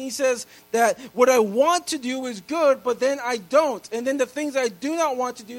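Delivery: loud, high preaching build; full-force preaching. Acted. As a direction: drive the point home to the congregation.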